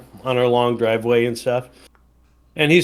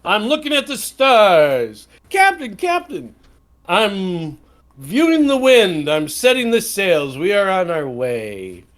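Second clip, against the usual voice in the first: pretend ship dialogue; 4 semitones higher than usual. Drummer and captain exchange.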